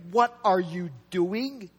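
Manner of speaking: slow, drawn-out "What are you DOING"; weight each word of "What are you doing"; emphatic questioning. Acted. Peter challenges Jesus over foot washing, emphatic questioning. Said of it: "It's all in the emphatic tense".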